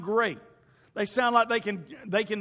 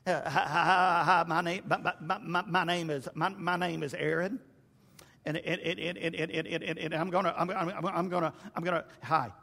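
nervous stuttering, stuck on each word, lower; Porky Pig stammer; 3 semitones lower than usual. Retold or imitated. Preacher mocking nervous speakers as a stuttering Porky Pig. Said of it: "sounding more like Porky Pig".

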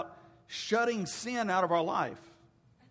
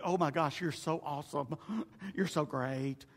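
mock gushing listeners; 6 semitones lower, 7 dB quieter than usual. Mocking audience gushing at reader.

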